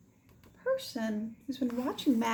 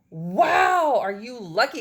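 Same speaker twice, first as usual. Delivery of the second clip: called out; 2 semitones higher, 10 dB louder, slower than usual. Acted. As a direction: shout the wow in envy. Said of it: "called Paco".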